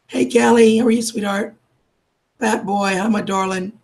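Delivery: usual speaking voice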